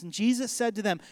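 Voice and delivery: normal talking voice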